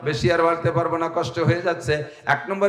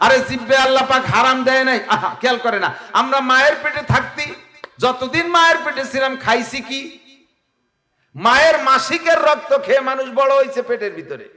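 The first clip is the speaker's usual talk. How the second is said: full-force preaching; 8 semitones higher than usual. Acted. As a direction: preach it out to the crowd, voice raised high. (উচ্চ স্বরে জোরালো ওয়াজ)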